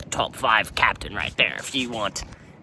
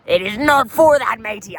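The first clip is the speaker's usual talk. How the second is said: much higher, louder indignant protest; outraged; 10 semitones higher, 9 dB louder than usual. Real, not acted. Pirate captain scolding shipmates who misuse the poop deck, outraged. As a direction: scold the first-time shipmates.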